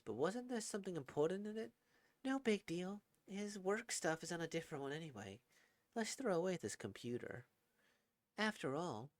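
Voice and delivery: a kind wife smiling about her husband; gentle old woman. Acted.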